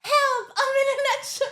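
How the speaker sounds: very high squeaky cry for help; tiny trapped voice. Pretend. Repeats squeaky gag.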